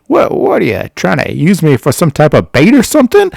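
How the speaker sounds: high, loud mock outrage; acted-out incredulous protest; indignant alarm. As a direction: imitate someone affronted and alarmed. Friend's alarmed indignation, retold.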